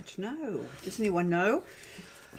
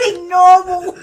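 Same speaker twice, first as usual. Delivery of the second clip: laughing; 6 semitones higher, 10 dB louder, faster than usual. Real, not acted. Laughing fit between hosts.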